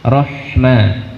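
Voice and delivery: "Rohma" with audible breath on h; breathy ha. Shown as a demonstration. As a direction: let the breath show on the h. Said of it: "Tampakkan hamas keluarnya nafas". (tampakkan hamas pada huruf ha)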